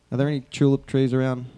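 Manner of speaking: ordinary talk, nothing marked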